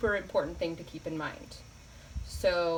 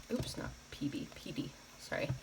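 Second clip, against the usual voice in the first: whispered aside; 5 semitones lower, 11 dB quieter than usual. Real, not acted. Muttered self-correction while writing.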